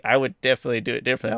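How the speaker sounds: usual speaking voice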